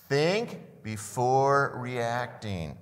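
very slow, each word spaced out; slow unison phrase. Shown as a demonstration. Pastor leading the church in saying it together, very slow. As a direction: lead the congregation through it slowly. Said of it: "Can we just say that together".

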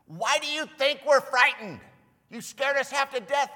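mock-offended shout; indignant retort. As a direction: fire back at him. Disciples answering Jesus back.

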